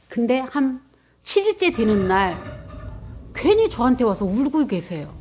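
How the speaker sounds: normal talking voice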